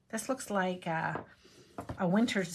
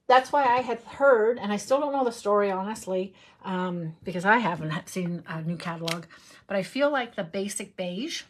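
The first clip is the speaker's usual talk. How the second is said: ordinary talk, nothing marked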